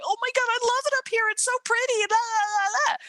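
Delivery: very high, rapid mock gushing; excited crowd. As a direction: imitate people squealing over the place. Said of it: "hear the excitement of the people".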